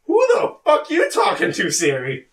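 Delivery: mock outrage. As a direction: snap at the phone like it insulted you. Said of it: "I'm like".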